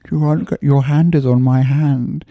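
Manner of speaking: high, slow put-on old-woman voice; old woman procurer. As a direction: draw out the offer like a caricatured old madam. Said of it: "ugly old women offering you prostitutes".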